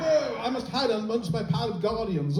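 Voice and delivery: high, mock-outraged Londoner; offended London audience. London audience reacting to an accent, hammed-up offence. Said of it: "if I did that in London, people go".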